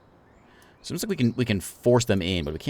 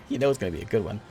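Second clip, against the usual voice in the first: usual speaking voice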